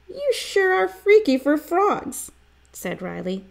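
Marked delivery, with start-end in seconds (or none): 0.1-2.3 very high-pitched playful jibe; teasing friend. A narrator's reading. Riley to frog-mad Stink, high teasing. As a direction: tease from way up high.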